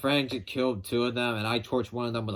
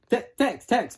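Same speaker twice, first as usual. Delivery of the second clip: higher, louder eager name-grab; excited; 11 semitones higher, 5 dB louder than usual. Retold or imitated. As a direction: blurt the name out. Fumbling the name, retold.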